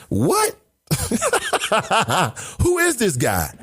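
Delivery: high disbelieving laugh breaking into a question; incredulous laugh. Real, not acted. Host laughing at an absurd claim about parents.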